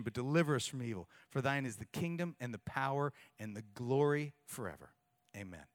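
low, soft, reverent recitation; quiet solemn prayer recitation; reciting the Lord's Prayer. Acted.

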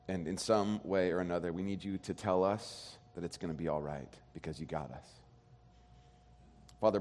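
soft, low, unhurried pastoral prayer. Real, not acted. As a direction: pray quietly and reverently to God. Pastor prays before the sermon.

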